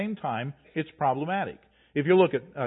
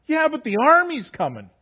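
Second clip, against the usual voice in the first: much higher and louder frantic retort; Israelites' panicked reply, much higher and louder; panicked excuse; 10 semitones higher, 8 dB louder than usual. Retold or imitated. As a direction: blurt it out in a panic.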